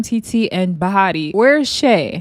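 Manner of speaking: normal talking voice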